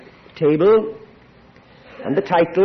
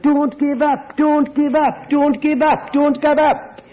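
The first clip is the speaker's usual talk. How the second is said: slow, raised, repeated urging; rising earnest appeal, lingering on each line; insistent plea; 8 semitones higher, slower than usual. Real, not acted. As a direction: press it home, higher each time. Preacher pleading with congregation.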